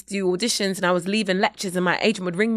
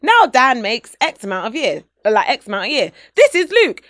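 upbeat American promo voice, louder; American accent; 3 semitones higher, 6 dB louder than usual. Pretend. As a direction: pitch it like a US school ad. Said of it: "I don't know why I'm doing American accent".

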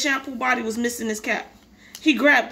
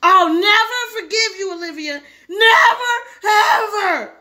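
high, loud tearful outburst; furious vow; 8 semitones higher, 6 dB louder, slower than usual. Acted. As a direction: hurl each word at your sister. Brother furious at sister.

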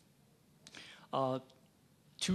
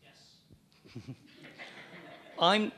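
ordinary talk

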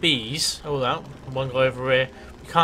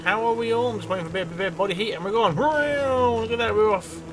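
cheery greeting; 8 semitones higher than usual. Acted. Greeting his bees as he sets off, a high jolly call. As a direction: sing out a jolly good morning to the troops.

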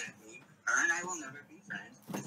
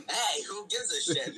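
mock yell; 7 semitones higher, 4 dB louder, slower than usual. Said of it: "Simon yelled".

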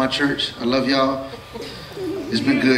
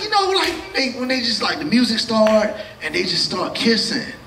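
put-on much higher, trailing kid's voice; a boy describing movies, pitched way up; son's voice; 7 semitones higher than usual. Son describes movie scenes.

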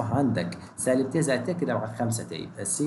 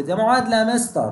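imitating a student; 8 semitones higher, 5 dB louder than usual. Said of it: "يجي واحد زي الدكتور يقول لي".

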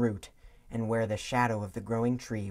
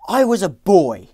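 loud, impatient outburst; 8 semitones higher, 16 dB louder than usual. A narrator's reading. Young Scrooge to the girl, impatient and loud. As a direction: brush her off impatiently. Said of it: "he said impatiently".

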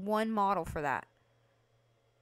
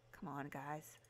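ordinary talk, nothing marked

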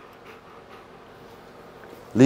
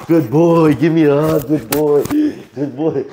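excited dog praise; 4 semitones higher, 4 dB louder, slower than usual. Real, not acted. Praising the dog after a trick.

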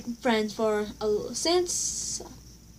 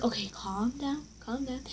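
soft self-soothing; calming self; 8 dB quieter than usual. Retold or imitated. Calming herself before going off, soft. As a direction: talk yourself down quietly. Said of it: "I was like".

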